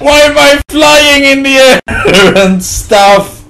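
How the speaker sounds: high, loud voice broken by laughs; incredulous, giggling shout; laughing bewilderment. Real, not acted. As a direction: ask it while cracking up. Laughing at game glitch.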